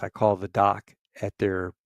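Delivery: ordinary delivery, nothing marked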